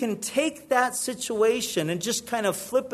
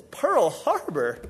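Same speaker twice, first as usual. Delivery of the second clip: slow, puzzled repeat of Pearl Harbor; drawn-out bewildered question; incredulous echo; slower than usual. Acted. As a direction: echo it back, unable to believe it. Chinese guy repeats in disbelief.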